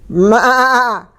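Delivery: high wavering goat bleat. A narrator's reading. Goat joining the commotion, high bleat. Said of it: "bleated Goat".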